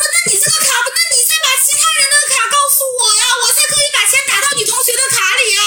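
coaxing scammer. Retold or imitated. Scammer soothing the crying student to get the code, high wheedling. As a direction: sweet-talk her into sending the code. Scammer asking for classmate's card.

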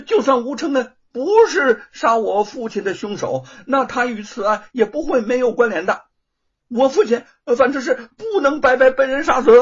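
loud, high, veins bulging fury; furious insistence. A narrator's reading. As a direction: force it out in rage. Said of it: "罗庞的脸上青筋暴动".

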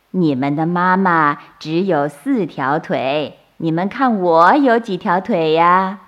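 ordinary delivery

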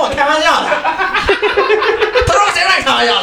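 giggling replay of the officials; laughing retelling. Retold or imitated. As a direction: squeal the lines through laughter. Retold exchange, laughing.

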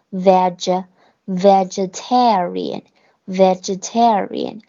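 "vegetarian" built up slowly from "veg", each syllable spelled out; slow syllable drill. Shown as a demonstration. Teacher drilling the class on a hard word, slow and careful. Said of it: "读tar，air".

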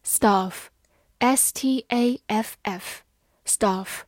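letter by letter, very slow; slow spelling. Shown as a demonstration. Teacher spelling a word slowly for students. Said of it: "spelling demo".

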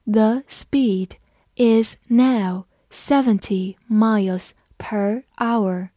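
slow, deliberate word-by-word reading. Shown as a demonstration. Teacher reading slowly for the class. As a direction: read it slowly for learners. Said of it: "还是慢读一遍".

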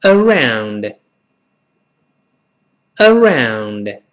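around drawn out very slowly, tongue tip curled back for r; slow model reading. Shown as a demonstration. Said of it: "跟老师一块儿读".

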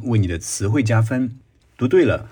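ordinary delivery

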